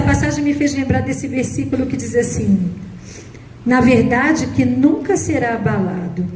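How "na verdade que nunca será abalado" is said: slow, solemn reading of the psalm; scripture reading. Acted. Preacher reading Psalm 112 to the congregation, slow and solemn. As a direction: read each verse deliberately, weighing it. Reads psalm verses aloud.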